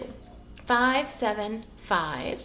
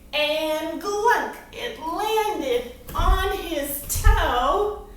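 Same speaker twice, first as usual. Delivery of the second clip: slow, drawn-out storytelling verse; poem recitation; 3 semitones higher, much slower than usual. Acted. Teacher performing a rhyming poem for children, slow theatrical recitation. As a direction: recite it slowly, lingering on each rhyme.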